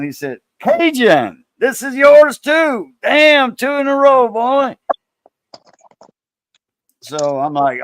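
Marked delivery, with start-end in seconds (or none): 0.6-4.8 high, loud, stretched-out congratulation; imitated excited announcement. Coin dealer telling a buyer he won twice, imitated excited shout. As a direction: call out the winner as an excited dealer would.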